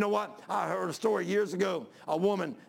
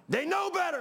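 high, ringing shout of the point; preaching shout; 10 semitones higher than usual. Real, not acted. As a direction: hammer it home to the church.

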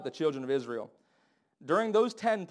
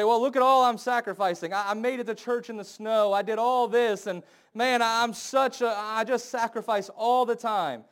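higher, louder self-pitying whine; whiny boaster; 6 semitones higher, 5 dB louder than usual. Retold or imitated. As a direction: brag about your sacrifice in a whiny voice. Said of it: "You say".

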